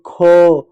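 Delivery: "ख" held so its "अ" ending rings; "ख" with a long, sustained "अ" tail; drawn-out vowel. Shown as a demonstration. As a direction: stretch the vowel after the consonant in "ख". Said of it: "last में अ ऐसे सुनाई देगा".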